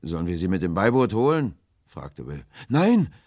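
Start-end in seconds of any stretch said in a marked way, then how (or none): 0.0-1.6 loud, rising question; calling an offer. A narrator's reading. Will offers the stranger help, calling out loudly. 2.7-3.1 high, loud, alarmed refusal; hasty cry. A narrator's reading. Stranger refuses the rescue, crying out hastily. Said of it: "rief die Stimme hastig".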